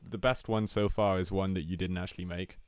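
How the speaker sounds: ordinary talk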